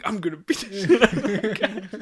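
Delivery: fast, high, loud laughing threat; mock threat yell. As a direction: rattle off the threat like a drunk hothead. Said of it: "he was like".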